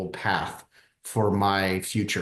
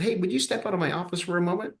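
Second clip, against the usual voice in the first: rushed request; 9 semitones higher, faster than usual. Retold or imitated. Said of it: "and he said".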